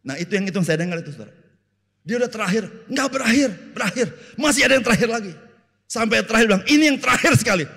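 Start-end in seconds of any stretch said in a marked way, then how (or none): 2.0-7.6 loud, high, building retelling of a never-ending sermon; rising comic outrage; mock exasperation. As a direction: pile it up louder and higher with each last. Mocking endless sermon ending.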